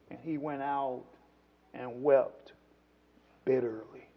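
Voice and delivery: very low, soft drop in voice; grave, quiet phrases with pauses between; hushed solemn reading. A narrator's reading. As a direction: let the verse sink almost to nothing. Solemn hush on Peter weeping.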